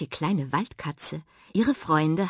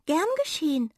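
little mouse voice; 10 semitones higher, faster than usual. A narrator's reading. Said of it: "sagte die Waldmaus".

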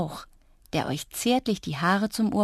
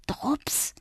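breathy whisper to her own reflection; 7 semitones higher, 6 dB quieter than usual. A narrator's reading. Girl at the mirror whispers Drups. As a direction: whisper his name hopefully. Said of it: "flüsterte ich meinem Spiegelbild zu".

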